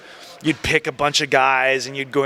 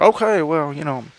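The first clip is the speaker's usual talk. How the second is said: Michael Hayes impression. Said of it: "That sounds like a Michael Hayes impression".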